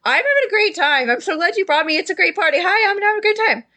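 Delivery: high, bouncy, overexcited party voice; giddy drunk Phineas. Acted. Drunk party guest greeting his friend, high and giddy. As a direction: gush at your friend like a happy drunk. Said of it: "And then he says".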